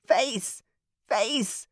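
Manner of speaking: raven repeating. A narrator's reading. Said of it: "Whisper repeated".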